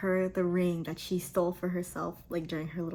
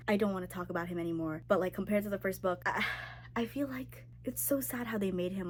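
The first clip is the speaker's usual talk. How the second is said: ordinary delivery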